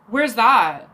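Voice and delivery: imitating an irritated date, with a huff; retold annoyance. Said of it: "He gets mad. Like, all of a sudden he says".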